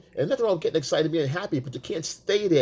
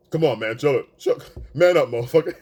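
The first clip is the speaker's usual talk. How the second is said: louder, exasperated scolding; annoyed girlfriend; 5 dB louder than usual. Retold or imitated. Girlfriend fed up with her gushing boyfriend, louder exasperated scolding. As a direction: tell him to knock it off.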